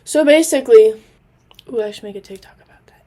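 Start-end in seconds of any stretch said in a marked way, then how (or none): 1.7-3.0 whispered aside. Real, not acted. Sudden aside to herself.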